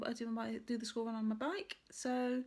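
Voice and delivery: usual speaking voice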